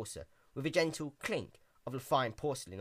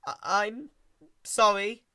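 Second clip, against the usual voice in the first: stammering; 8 semitones higher, 5 dB louder, slower than usual. Acted. Caramel stammers apology to Twilight.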